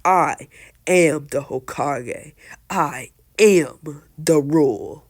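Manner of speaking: loud, slow, weighty words; forceful declaration. Retold or imitated. Lord Third asserts his rule over the council, loud and slow. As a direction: hammer out each word slowly and loudly. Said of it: "and Lord says".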